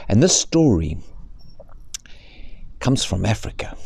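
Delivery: ordinary delivery, nothing marked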